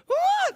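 a sudden piercing scream, way up high and loud; imitated scream. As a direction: let out the mother's shriek from the elevator. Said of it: "this scream that I can still hear".

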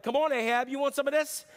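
high, brash swagger; cocky challenge. Retold or imitated. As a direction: square up to Ahab like a cocky fighter. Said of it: "He's a cocky dude".

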